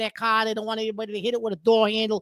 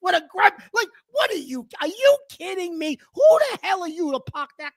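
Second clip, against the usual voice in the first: higher, louder exasperated yelling at the driver; angry rant; 5 semitones higher, 5 dB louder than usual. Real, not acted. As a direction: tear into the guy who took two spaces. Ranting at double-parked driver.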